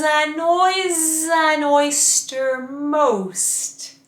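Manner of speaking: very slow, every n and z stretched; elongated consonants. Shown as a demonstration. Coach showing elongated consonants for mask speaking. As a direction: drag out each n in any noise. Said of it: "elongating your consonants".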